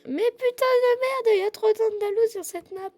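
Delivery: loud, high, exasperated complaint; exasperated outburst. Pretend. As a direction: throw up your hands and exclaim. Mock outburst at too many horses.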